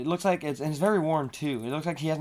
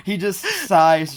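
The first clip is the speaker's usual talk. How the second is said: usual speaking voice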